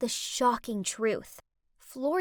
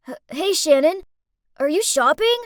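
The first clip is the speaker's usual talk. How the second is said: nervous splutter; 3 semitones higher, 8 dB louder than usual. Acted. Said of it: "then spluttered out".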